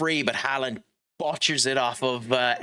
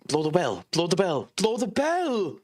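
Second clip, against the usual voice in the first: rising desperate plea; 5 semitones higher than usual. Real, not acted. Begging for full time.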